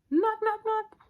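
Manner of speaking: high, loud knock-knock sound; mock knocking. Storyteller voicing a knock at the door.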